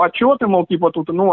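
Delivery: ordinary talk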